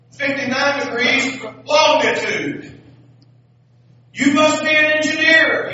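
high, loud, slow exact answer shouted up; calling up. Acted. Lady on the ground shouting precise directions up, loud and high. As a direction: yell the facts up to the balloon, high.

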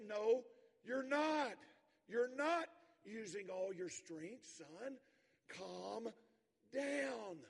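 father firmly calming his shouting son. Retold or imitated. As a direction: override the boy's fit, then settle him. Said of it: "His father says".

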